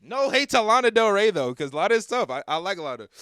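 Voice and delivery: sudden high, loud burst of protest; loud defensive disclaimer. Real, not acted. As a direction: shout up in defense of the singer, easing slightly. Jumps in loudly defending the singer.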